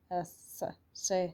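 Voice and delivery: एस then से, drawn out slowly; slow teaching demo of एस as से; letter S said as se. Shown as a demonstration. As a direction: stretch एस and से slowly so the sound carries. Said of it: "एस को हम से से बोलेंगे".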